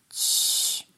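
long voiceless shush. A narrator's reading. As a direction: hush him with a drawn-out hiss. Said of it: "uciszał go Trevor".

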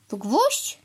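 ordinary delivery

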